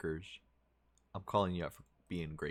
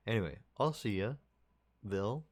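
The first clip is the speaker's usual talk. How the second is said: usual speaking voice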